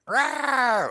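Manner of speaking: high sharp mock screech; imitated eagle screech. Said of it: "whenever they do the, like".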